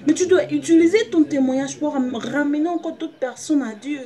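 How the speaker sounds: high, loud impassioned preaching. Acted. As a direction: cry it out to the church. Preaching at full force.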